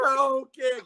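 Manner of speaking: high, wailing mock sob for mama; mock crying. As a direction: blubber like a grown man crying for his mother. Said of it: "he's crying all over the shit".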